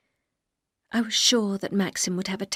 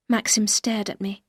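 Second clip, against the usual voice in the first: usual speaking voice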